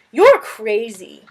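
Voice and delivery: slow, dry scorn; sarcastic. Acted. Girl scoffing at the lizard, slow and sarcastic. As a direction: drag it out in disbelief. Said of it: "Shiera said sarcastically".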